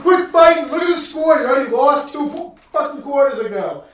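loud, high taunting shout; mocking shout. Fan taunting the losing team, loud mocking shout. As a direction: yell down at the bench to rub it in. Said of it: "The guy's like".

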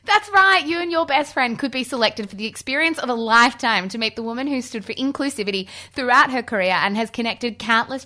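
big, high, loud ad announcer; booming prize announcer. Acted. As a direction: sell the prize like a game show host. Sponsored Bumble prize ad read.

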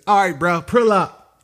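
lightly voiced dismissive worker; imitating the clerk. Quoting the Subway worker at the drive-through, mock casual call. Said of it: "that's exactly what he said".

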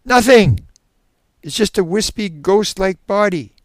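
high, loud, frightened burst; shuddering fear. Acted. Shuddering subject describes the figure, frightened. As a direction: blurt it loudly, then draw out the words. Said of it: "The man is shuddering violently".

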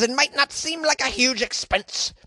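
high, badly faked Scottish female voice; Scottish woman's accent. Host quoting Monica Lennon, badly faked female Scottish accent. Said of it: "Scottish accent that's also in a female voice".

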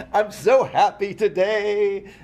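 slow, loud, gushing delight; practically shouting with joy; excited. Real, not acted. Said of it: "You're, like, screaming".

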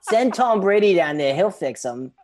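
put-on loud Boston accent, starting high; modern Boston accent. Colonist calling for reinforcements, mock Boston accent. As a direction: call it out like a Boston townsman. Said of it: "speaking in modern-day Boston accents".

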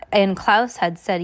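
ordinary delivery

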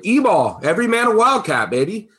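high-pitched, loud fan chant; excited rallying cry. Acted. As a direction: whoop it up like a team slogan. Rallying cry for his team.

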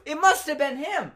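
high-pitched mock protest; blame-shifting protest. Brother blaming his other brother, mock indignant.

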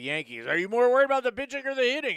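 a hint of a radio host; quick, brassy call-in host question; mock radio host. Host parodying the radio station's question. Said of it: "They were like".

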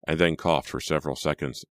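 usual speaking voice

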